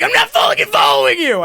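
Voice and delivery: high, loud panicked yell; mental scream. Retold or imitated. Man's brain screams at the one he's following, panicked. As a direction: scream the denial as if inside your head. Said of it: "I didn't scream that, but my brain's like".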